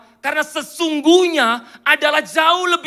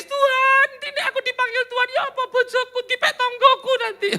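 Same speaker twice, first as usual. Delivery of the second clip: high, hammed-up sobbing plea; exaggerated whiny lament, pitched way up; mock crying; 13 semitones higher than usual. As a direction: wail to God in a comic tearful voice. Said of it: "Dia bahkan nggak nangis".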